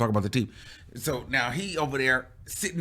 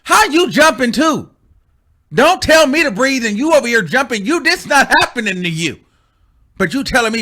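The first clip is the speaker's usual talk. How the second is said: loud, high indignant rant; mock scolding; 11 semitones higher, 10 dB louder than usual. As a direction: tell off the jumpy best friend. Scolds friend in retelling.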